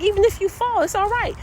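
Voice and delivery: high, eager coaxing; encouraging. Retold or imitated. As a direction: cheer him through the balance. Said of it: "He's like".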